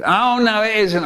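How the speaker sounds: high, stretched-out contradiction; pantomime denial. A narrator's reading. Cafe owner denies the rat to inspector, high pantomime denial. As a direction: insist like a panto chorus.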